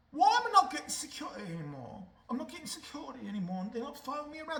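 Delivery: high whining mock complaint; whiny prince. Mocking the prince's whine about losing security, high and whiny. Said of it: "had the nerve to go".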